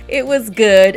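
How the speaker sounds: laughing through the words. Real, not acted. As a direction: giggle it out. Laughing while talking about ranch.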